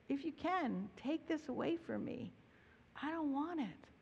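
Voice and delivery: pleading prayer. Retold or imitated. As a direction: beg quietly, voice lifted and hushed. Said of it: "pleading with God".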